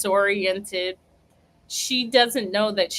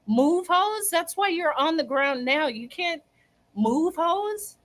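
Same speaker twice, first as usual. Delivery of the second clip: higher-pitched teasing echo of her words; mock taunting; 7 semitones higher than usual. Friends taunt the fallen woman with her words, mock taunting.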